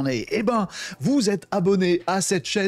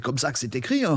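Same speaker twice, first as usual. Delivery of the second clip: normal talking voice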